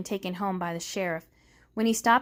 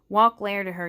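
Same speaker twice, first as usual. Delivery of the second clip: ordinary delivery, nothing marked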